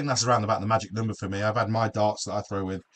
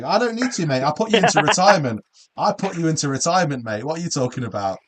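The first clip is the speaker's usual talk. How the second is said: higher-pitched, playful protest; mock indignation; 6 semitones higher, 5 dB louder than usual. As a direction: banter back at a cheeky rival, hammed-up outrage. Mock indignant reply to chat jibe.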